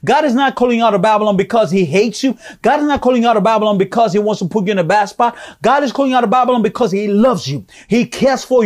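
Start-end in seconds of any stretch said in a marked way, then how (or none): none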